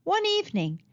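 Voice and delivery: usual speaking voice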